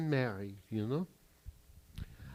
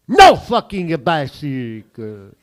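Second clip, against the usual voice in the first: loud, slow, furious punchline; loud slow furious complaint about no bicycle; angry outburst; 19 dB louder, slower than usual. Acted. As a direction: spit it out in disgust, dragging each word.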